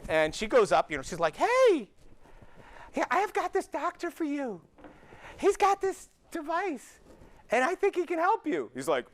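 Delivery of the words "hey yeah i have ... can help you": high, bright put-on woman's voice; upbeat Corinne voice. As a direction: pitch it eagerly to him. Corinne voiced pitching the doctor.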